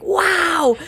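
high, loud wow; excited boy. Retold or imitated. Mother voicing an overexcited boy, high and loud. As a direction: burst with a child's energy. Imitating a bombastic little boy.

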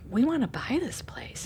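soft, breathless whispered wish. Retold or imitated. Husband and wife quietly agreeing on the land, whispered. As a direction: whisper it like a shared secret. Couple sharing a silent look.

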